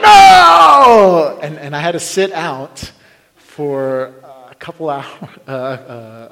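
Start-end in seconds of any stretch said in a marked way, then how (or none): 0.0-1.4 long, high, loud wail of no; hammed-up yell of being taken out; dramatic cry of defeat. Acts out being shot out.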